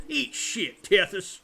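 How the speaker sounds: loud, high burst of insult; angry outburst. Acted. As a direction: spit out the insult at full volume. Game character answers angrily.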